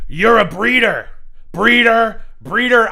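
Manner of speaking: loud, slow, high jeering chant; mock taunt. Imagined insult from him, a loud, high, slow jeer. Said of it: "I'd probably been madder if he was like".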